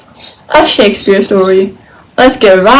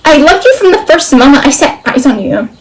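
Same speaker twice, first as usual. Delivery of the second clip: higher, mildly impassioned declaration; exclaiming; 4 semitones higher, 5 dB louder than usual. Acted. Olivia confesses her love to Viola, exclaiming. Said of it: "exclaimed Olivia".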